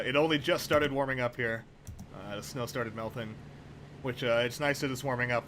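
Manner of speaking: ordinary talk, nothing marked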